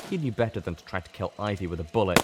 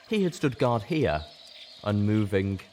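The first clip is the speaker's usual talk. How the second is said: ordinary delivery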